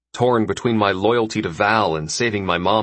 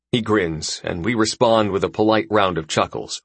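usual speaking voice

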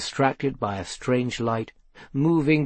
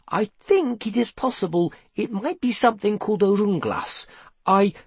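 put-on tentative boy; 7 semitones higher than usual. A narrator's reading. Seth hesitantly explains the runglas.

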